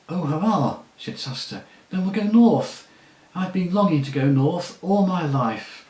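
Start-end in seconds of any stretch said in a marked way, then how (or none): 0.1-0.8 excited cheer. A narrator's reading. Boy cheering at going north. 1.9-5.9 much higher and louder, bursting with joy; excited. A narrator's reading. Boy to the horse, overjoyed to head north.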